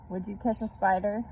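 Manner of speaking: ordinary delivery, nothing marked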